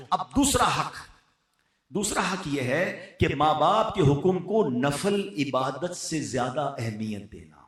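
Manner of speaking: ordinary delivery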